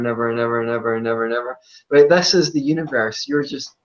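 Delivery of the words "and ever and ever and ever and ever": fast running repetition; rapid-fire. Pretend. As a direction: rattle it off faster and faster. Rattles off endless repetition.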